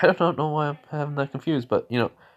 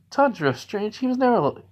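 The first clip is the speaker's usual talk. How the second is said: high little-sister voice; 10 semitones higher than usual. Acted. Nezuko voiced, surprised.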